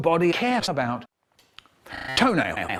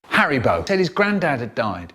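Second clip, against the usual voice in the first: usual speaking voice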